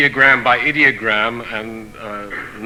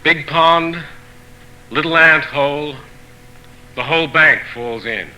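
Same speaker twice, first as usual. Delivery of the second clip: measured proverb recital, each phrase starting high and falling at the end; poetry reading; 4 semitones higher than usual. Acted. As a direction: read each saying in separate phrases, pausing between them. Poet reads translated proverbs aloud.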